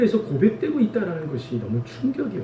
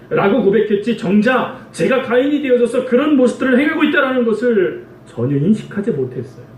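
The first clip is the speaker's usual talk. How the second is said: normal talking voice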